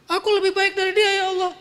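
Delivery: haughty defiant devil voice; arrogant Iblis. Acted. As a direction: sneer it out as the proud devil. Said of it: "Tapi iblis".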